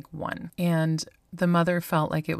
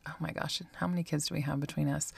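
ordinary talk

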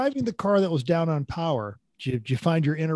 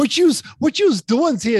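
mock Jersey cop, a bit louder; South Jersey accent; 8 semitones higher, 5 dB louder than usual. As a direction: lay the Jersey accent on thick for a laugh. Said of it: "it was South Jersey".

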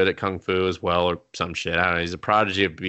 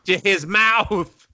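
"To his mouth" slowed and spelled out, higher; slow emphatic answer; 9 semitones higher, 4 dB louder, much slower than usual. Shown as a demonstration. Answering the co-host who missed the word, slow and emphatic. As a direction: drag out "To his mouth" so it lands. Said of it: "She French kisses it into what?".

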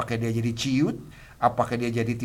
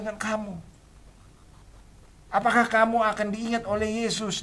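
higher, mocking scold; scolding crowd; 11 semitones higher than usual. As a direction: put him down for making noise. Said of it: "orang mungkin berkata".

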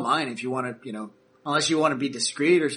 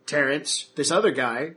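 ordinary talk, nothing marked

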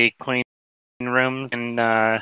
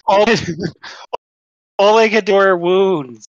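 hammed-up lament; 6 semitones higher, 3 dB louder than usual. Patient explaining only wounds get treated, hammed-up lament. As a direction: wail it lightly in character.

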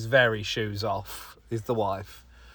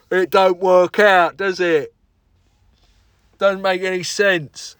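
higher, much louder, slow mock voice; imitating Justin; 6 semitones higher, 8 dB louder, slower than usual. Mocking co-host Justin's flat-earth logic, slow and loud. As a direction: ham up a dim know-it-all. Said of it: "That's how he talks".

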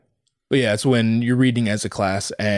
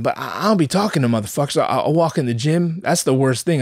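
ordinary delivery

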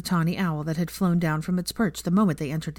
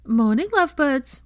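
cheery call; 9 semitones higher, 7 dB louder than usual. Acted. Cheery greeting called across the hall.

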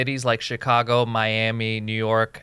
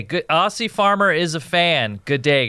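ordinary talk, nothing marked

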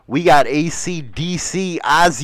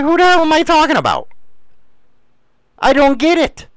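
high-pitched, incredulous comeback; baffled protest; 10 semitones higher, faster than usual. Retold or imitated. Host answering people who felt targeted, high baffled protest. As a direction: protest your innocence, voice pitched way up. Retelling his baffled reply to accusation.

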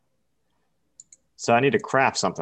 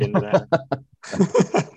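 laughing; 10 semitones higher, 3 dB louder, much slower than usual. Real, not acted. Laughing while asking.

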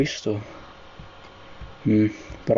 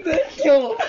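laughing. Real, not acted. Bursts laughing after the call.

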